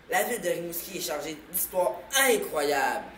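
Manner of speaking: usual speaking voice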